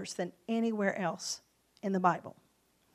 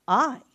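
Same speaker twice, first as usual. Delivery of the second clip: "I" punched, louder and held alone; emphasis falls on "I"; stress on I; 3 semitones higher, 7 dB louder than usual. Shown as a demonstration. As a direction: hit the word "I" hard, then pause. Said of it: "the emphasis on a particular word".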